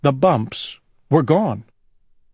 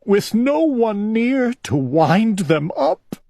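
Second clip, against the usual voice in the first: crying; 9 semitones higher than usual. A narrator's reading. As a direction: let the sadness drag it. Owl crying.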